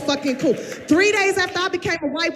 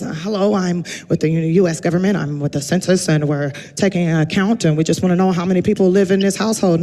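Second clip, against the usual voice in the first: lower, polite official voice; census man voice; 8 semitones lower than usual. Retold or imitated. Census man at her door, low polite official. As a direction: play the census taker, stiff and formal. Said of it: "he goes".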